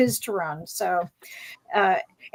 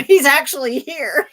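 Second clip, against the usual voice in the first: laughing; 5 semitones higher, 5 dB louder than usual. Real, not acted. Laughing at punchline of anecdote.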